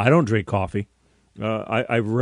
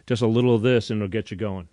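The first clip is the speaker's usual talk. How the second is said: ordinary talk, nothing marked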